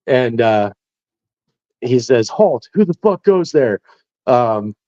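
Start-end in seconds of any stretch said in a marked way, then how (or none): 2.3-3.8 pitched-up mock sentry challenge; sentry's challenge. Point man challenging his own platoon sergeant, mock sentry challenge. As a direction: imitate a sentry calling out, voice raised high. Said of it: "you're supposed to say".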